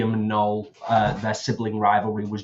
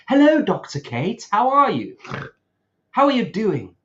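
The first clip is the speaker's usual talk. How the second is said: high-pitched, playful mock hello; put-on greeting voice; 9 semitones higher, 4 dB louder than usual. Hosts rehearsing a hello to Doctor Kate, put-on voice. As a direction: greet them in a silly, much higher voice. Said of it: "Well, in that voice".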